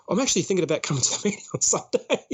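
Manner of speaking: putting it on, breaking into laughter; feigned sincerity, amused. Retold or imitated. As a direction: tell an obvious fib through a laugh. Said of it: "so I'm lying".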